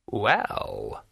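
drawn-out ancient voice; an old dead man easing into an explanation; long, lingering old-man delivery; brittle old voice. A narrator's reading. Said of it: "in a brittle old voice".

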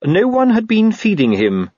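high-pitched, mild reproach; mildly accusing. A narrator's reading. Constable tells superiors of the neglected imp, mildly accusing. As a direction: lay gentle blame, voice lifted. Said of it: "in a mildly accusing tone".

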